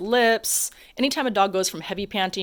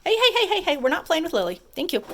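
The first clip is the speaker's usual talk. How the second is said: sharp hey; 9 semitones higher than usual. Retold or imitated. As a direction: interrupt the dog firmly. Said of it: "gave him a little thump".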